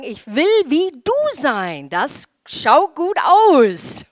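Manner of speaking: loud mock teenager gushing; imitating teenagers. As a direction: ham up a teen admiring a friend. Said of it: "einander anschauen und sagen".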